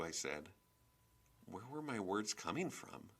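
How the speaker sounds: ordinary delivery, nothing marked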